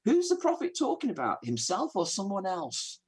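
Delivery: bewildered eunuch asking Philip; confused question. Retold or imitated. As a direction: ask it like a baffled reader stuck on the text. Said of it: "the guy's so confused".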